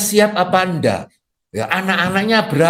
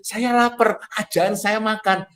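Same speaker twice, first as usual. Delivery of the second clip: woman's hint; 6 semitones higher, slower than usual. Retold or imitated.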